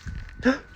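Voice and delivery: a sharp, high intake of breath; surprised gasp. Real, not acted. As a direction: gasp in delight. Gasps at a username.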